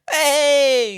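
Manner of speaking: high, loud drawn-out whoop; rowdy cheer. Real, not acted. Podcaster cheering a friend's slang word, rowdy. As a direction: shout it like a celebration.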